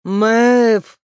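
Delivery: loud, drawn-out shout; distant call. A narrator's reading. Bark shouting to Maeve through thunder, long loud call. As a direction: call the name across the storm. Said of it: "голос Барка, заглушённый громом".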